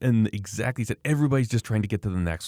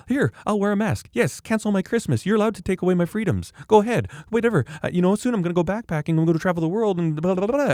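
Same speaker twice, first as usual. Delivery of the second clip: much higher, louder mock voice of a compliant citizen; 13 semitones higher, 5 dB louder than usual. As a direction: parody someone happily giving up freedoms. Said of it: "people now are just like".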